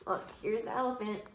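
ordinary delivery

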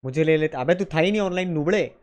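ordinary talk, nothing marked

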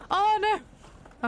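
high, loud oh no; dismayed cry. Real, not acted. Watching Lady Ranger lose, a high loud cry. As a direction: cry out as the race slips away. Horse loses race, dismay.

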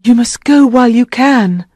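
high, urgent queen's voice; anxious high woman's voice; Guinevere. A narrator's reading.

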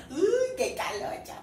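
high, slow, drawn-out squeal; giddy high-pitched exclamation. Real, not acted. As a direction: squeal it with the first sound stretched. Squeals after a laugh.

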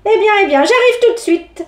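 high, giggly witch voice; a witch giggling, pitched well up; clucking witch. Acted. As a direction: cluck with glee. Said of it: "gloussa-t-elle".

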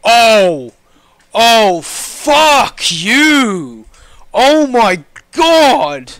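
loud, drawn-out exasperated cursing; exasperated outcry. Real, not acted. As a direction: drag out each word in loud disbelief. Error after long queue wait.

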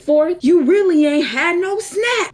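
high, drawn-out indignant outburst; mad tirade. Retold or imitated. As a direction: hammer the last complaint slowly and high. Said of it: "I was just so mad".

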